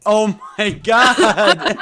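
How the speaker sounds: drawn out, high through laughter; laughing. Real, not acted. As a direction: let the laugh carry the words. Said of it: "laughing reaction".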